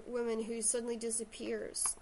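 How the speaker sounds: usual speaking voice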